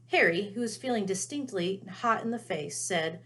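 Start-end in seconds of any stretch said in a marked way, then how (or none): none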